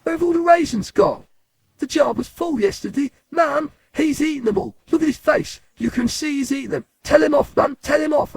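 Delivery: high-pitched indignant tattling to Mum; outraged brother. Acted. Brother telling Mum about the empty jar, outraged. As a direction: complain at the top of your register, outraged. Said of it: "and he goes".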